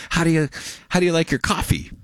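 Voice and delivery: high-pitched imitation of an advert; commercial impression. Host quotes the coffee commercial, high-pitched imitation.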